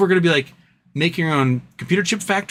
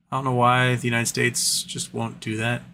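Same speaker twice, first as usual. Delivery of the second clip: usual speaking voice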